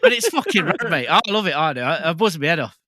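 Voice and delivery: high, loud, thrilled rave; thrilled outburst. Real, not acted. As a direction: gush it out at the top of your voice. Raving about the figure.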